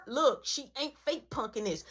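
ordinary delivery

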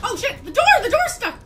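loud, high cries of panic; panicked shouting. Real, not acted. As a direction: shout in alarm while trying to escape. Fleeing, door will not open.

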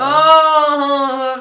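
excited. Real, not acted. Still thrilled by the look.

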